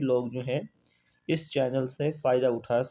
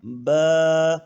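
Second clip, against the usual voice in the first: Quranic recitation drill; 3 dB louder, much slower than usual. Shown as a demonstration. Teacher chanting the lesson's letters for students, drawn out. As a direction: intone each letter slowly like a recitation.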